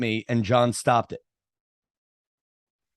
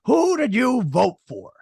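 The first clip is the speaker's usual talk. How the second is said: put-on drunk guy demanding; 9 semitones higher, 3 dB louder than usual. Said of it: "and then he goes".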